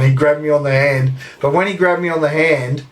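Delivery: normal talking voice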